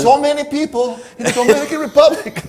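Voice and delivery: put-on laughing Spanish accent, pitched high and loud; hammed-up Spanish accent with a laugh. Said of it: "Should I talk in a Spanish accent?".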